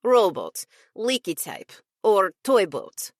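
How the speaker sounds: lightly voiced Russian accent; a hint of Russian vowels. Russian assistant teasing about boats, light Russian accent. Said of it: "in a Russian accent".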